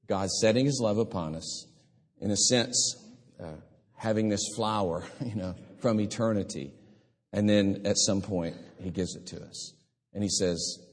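ordinary talk

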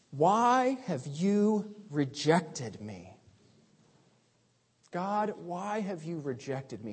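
slow, hushed plea of rejection; quiet lament. Acted. Preacher voicing the psalmist's lament to God, slow and hushed. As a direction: ask it slowly, barely above a breath.

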